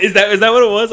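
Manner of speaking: can barely get the question out for laughing. Real, not acted. Laughing hard at the memory.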